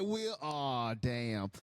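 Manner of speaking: usual speaking voice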